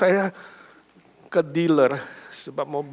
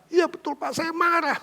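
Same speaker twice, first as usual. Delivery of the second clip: mother's voice pitched up high; 11 semitones higher than usual. Retold or imitated. Mother agreeing with the passenger, pitched high. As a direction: play the indignant mother up high.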